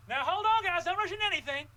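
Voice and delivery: high, loud call to stop them. Acted. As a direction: shout after the students running off. Counselor calls after fleeing students.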